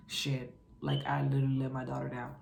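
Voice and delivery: low, quick disappointed dad; a dad muttering in letdown. Retold or imitated.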